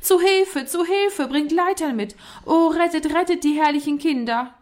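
high, pitiful wail of alarm; panicked little scholar calling out; plaintive cry for help. A narrator's reading. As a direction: plead pitifully for rescue, pitched well up. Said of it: "rief mit kläglicher Stimme".

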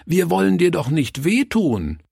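put-on high, plaintive children's protest; whining children. A narrator's reading. Child robbers not wanting to hurt the pastor, whining. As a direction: plead in a high child's whine. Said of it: "jammerten die Räuber".

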